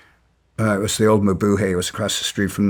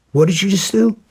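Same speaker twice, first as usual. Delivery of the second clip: retold demand, much higher and louder; angry shout; 10 semitones higher, 3 dB louder than usual. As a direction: repeat it, now blowing up. Confronting man who kicked his dog.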